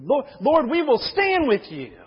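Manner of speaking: loud, high rallying shout; battle cry. Acted. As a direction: rouse the crowd like a war cry. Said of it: "their battle cry".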